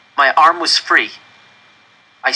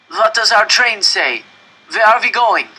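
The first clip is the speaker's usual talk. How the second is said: calling across; 10 semitones higher, 5 dB louder than usual. A narrator's reading. Stranger in the other train, shouting anxiously. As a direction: shout the question over to the other car. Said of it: "the man in the other train asked".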